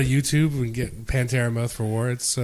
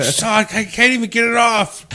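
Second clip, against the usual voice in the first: high, exasperated mock complaint; imitated angry yelling; 8 semitones higher than usual. Victim failing to scrape dried dip off, exasperated mock yelling. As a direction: act out the victim's frustrated outburst. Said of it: "he's, like, yelling and swearing at us".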